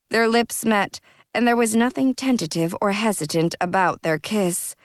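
ordinary delivery

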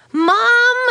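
loud, high call for Mom; shouting. A narrator's reading. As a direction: yell urgently through the house. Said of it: "Katie shouted".

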